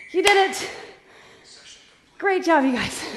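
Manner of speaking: winded after the workout; out of breath. Real, not acted. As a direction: talk while catching breath. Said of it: "I'm winded, as you can tell".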